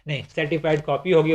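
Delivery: usual speaking voice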